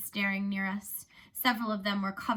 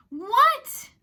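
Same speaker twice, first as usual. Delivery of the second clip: much higher and louder, pressing hard; insistent what; 14 semitones higher, 12 dB louder than usual. Acted. Narrator pressing alarmed Zion, loud insistent question. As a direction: demand an answer now. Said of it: "I said again".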